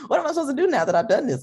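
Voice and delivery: high, loud, fast fretting; mock panic. Achiever unsure after reaching the goal, mock panic. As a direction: rattle it off in playful alarm. Acts out lost feeling after success.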